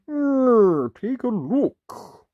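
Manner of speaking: high, slow wolf voice; hesitant, drawn out wolf. Acted. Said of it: "He said".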